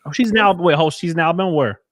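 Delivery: loud, startled double-take; surprised. Real, not acted. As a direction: catch yourself mid-sentence, stunned. Reacting in surprise to clip.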